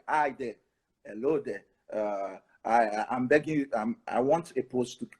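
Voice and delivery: mock bad email. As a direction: imitate a timid begging student. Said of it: "Don't use like".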